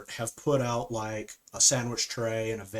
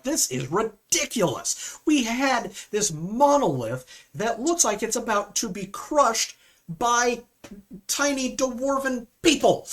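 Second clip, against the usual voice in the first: high, loud hammed-up rant; exaggerated indignation, building louder and slowing for emphasis; mock outrage; 12 semitones higher, 5 dB louder than usual. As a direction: act out an indignant rock star's backstage tirade. Acting out backstage outrage over monolith.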